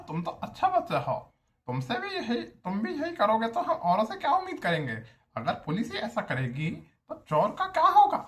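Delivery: lightly voiced HOD's accent, rising high and loud at the end; mock the HOD's accent as he scolds a good student; HOD's accent lecture. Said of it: "literally यही accent था". (HOD के लहजे में उपदेश, आख़िर में ऊँची आवाज़)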